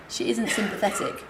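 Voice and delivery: ordinary talk, nothing marked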